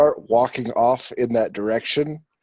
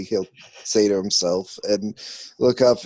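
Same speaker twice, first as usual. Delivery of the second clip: ordinary delivery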